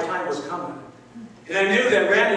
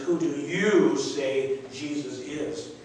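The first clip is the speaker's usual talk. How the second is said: slow solemn; 5 semitones lower, 5 dB quieter, much slower than usual. Acted. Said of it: "The question is".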